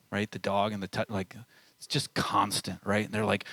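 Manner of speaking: ordinary talk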